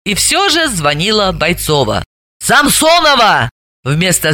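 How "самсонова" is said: higher, drawn-out indignant exclamation. A narrator's reading. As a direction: cry the surname out in indignation. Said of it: "возмутилась она". (возмущённый возглас)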